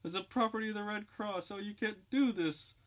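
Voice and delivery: put-on a girl terrified she has been kidnapped; shrill panic. Kidnapped Red Cross girl begging the dope fiends, shrill panic. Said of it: "the girl's voice rising to an alarming intensity".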